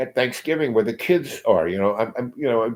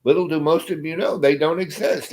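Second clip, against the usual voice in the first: higher, smug joke tossed to the crowd; mocking aside; 8 semitones higher than usual. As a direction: sneer it out, pitched up. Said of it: "And then he says".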